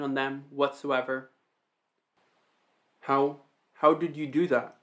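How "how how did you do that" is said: mildly stammered astonishment; stuttering. A narrator's reading. Io questioning the shape-shifter, lightly stuttering. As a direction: trip on the first word, then ask. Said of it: "Io stuttered".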